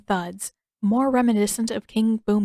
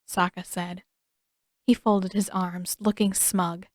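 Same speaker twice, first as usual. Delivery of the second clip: plain reading voice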